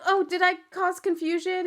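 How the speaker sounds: high mock-innocent question; imitated drunk woman. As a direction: play a tipsy friend, pitched high. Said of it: "she is just absolutely fucked up".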